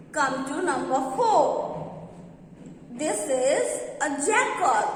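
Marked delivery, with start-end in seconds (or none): none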